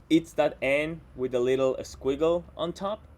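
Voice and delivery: usual speaking voice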